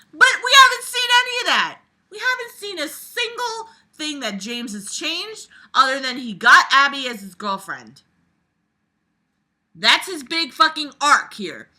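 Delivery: loud, high-pitched rant at the book; loud exasperated rant. Real, not acted. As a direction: vent your frustration loudly. Ranting at the book.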